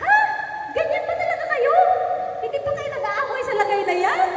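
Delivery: high-pitched mock incredulous questioning; retold disbelief. Husband questions wife about the quarrelling, mock incredulous. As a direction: act out a baffled husband, pitch jumping up at first.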